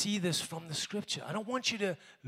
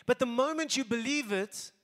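ordinary talk

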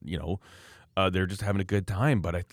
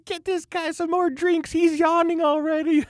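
very high, louder squeal of a tipsy woman; drunk woman shrieking; 5 dB louder than usual. Retold or imitated. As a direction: squeal it like a drunk lady ordering drinks. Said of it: "She turns out".

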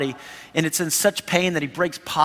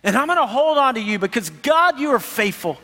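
higher, pleading prayer to God; earnest prayer; 6 semitones higher than usual. Pretend. Struggling believer praying to God, higher and pleading. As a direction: plead your faith upward. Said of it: "they pull in and just say".

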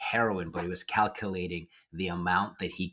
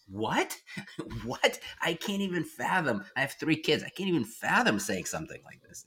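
high-pitched, baffled disbelief; incredulous; 8 semitones higher than usual. Retold or imitated. Therapist recalling a father's demand, incredulous. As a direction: react in stunned disbelief, pitched well up.

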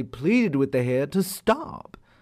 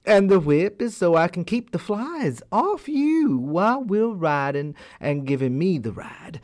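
sly hare; 6 semitones higher than usual. Acted. Sly hare reassures the tiger in a higher voice. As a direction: play it all innocent.

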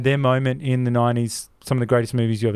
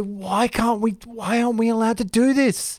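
higher, pleading lament; frustrated plea; 8 semitones higher than usual. Retold or imitated. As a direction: ask it like a filmmaker who feels cheated. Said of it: "I go".

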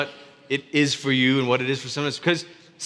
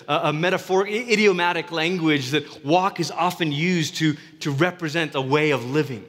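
usual speaking voice

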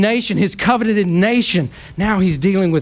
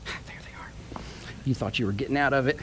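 whispered aside; 6 semitones lower, 13 dB quieter, faster than usual. Real, not acted. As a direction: whisper it off-mic to yourself. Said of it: "notes found, aside".

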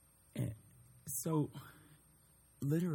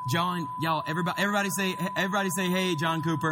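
loud, higher-pitched call to a group; rallying the crowd; 6 semitones higher, 5 dB louder, faster than usual. Real, not acted. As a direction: whip the room up to shout a greeting. Rallying youth group to greet caller.

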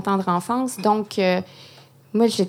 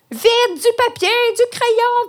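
much higher, louder urgent shout; reenacted shout; 13 semitones higher, 5 dB louder, slower than usual. Calling her boyfriend from the bath, reenacted shout. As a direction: yell it from the bathtub like it can't wait. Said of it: "je crie".

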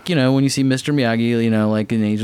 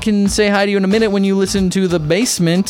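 higher-pitched radio announcer patter; radio sign-off; 6 semitones higher than usual. Acted. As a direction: read out the station sign-off to listeners. Radio host signs off.

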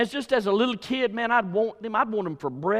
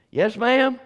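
put-on boy calling back; 5 semitones higher, 8 dB louder than usual. Boy answering mama's call.

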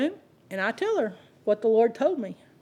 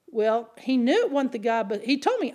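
usual speaking voice

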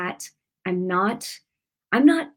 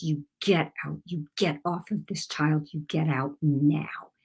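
soft, slow and firm command, fading to a whisper; low-key rebuke that ends in a hushed whisper; quiet authority; 3 semitones lower, 5 dB quieter than usual. Retold or imitated. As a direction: command the spirit to leave without raising your voice. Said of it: "I said it not loud, but I said it with authority".

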